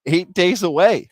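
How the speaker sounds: ad read. Acted. Host counts down to holiday season, excited ad read. Reads a sponsor ad.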